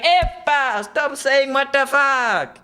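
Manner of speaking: high, loud slow Italian scolding; angry Italian father. Retold or imitated. Father scolds the guest, loud Italian accent. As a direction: scold him like an outraged dad. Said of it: "the father's like".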